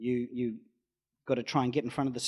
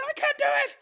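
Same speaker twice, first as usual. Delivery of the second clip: much higher mock panic; mock panicked wail; 5 dB louder, faster than usual. Partner refusing to keep driving, high mock panic. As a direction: wail that you can't go on.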